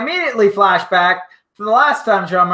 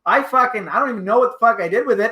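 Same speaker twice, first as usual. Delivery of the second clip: normal talking voice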